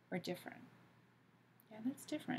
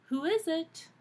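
put-on higher child voice; small child calling through a door; child's voice; 8 semitones higher, 4 dB louder than usual.

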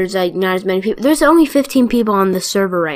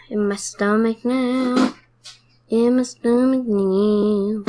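slow gloat; 3 semitones higher, much slower than usual. Pretend. Gloating over eaten cell.

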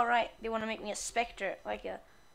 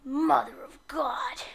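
slow breathless whisper; whispered shock at the giant ship; awed whisper; 3 semitones higher, 6 dB louder, slower than usual. Real, not acted. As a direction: gasp it out in awe.

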